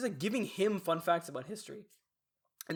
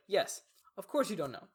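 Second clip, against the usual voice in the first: sarcastic retort; 8 semitones higher than usual. Retold or imitated.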